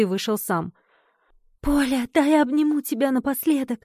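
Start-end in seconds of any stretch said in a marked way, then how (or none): 1.6-3.8 higher, tearful plea; lightly acted tearful farewell; whispering through tears. A narrator's reading. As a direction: plead as if holding back tears. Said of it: "прошептала Глаша сквозь слёзы".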